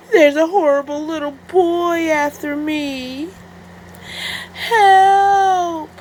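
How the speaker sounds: high, loud, slow crying yell; fake panicked yell. Wolf fakes danger to the shopkeepers, loud crying yell. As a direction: ham up the fear, wail it. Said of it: "yelled at the top of his lungs".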